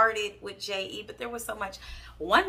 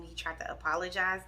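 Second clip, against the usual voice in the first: usual speaking voice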